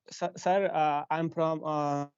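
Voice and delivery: ordinary talk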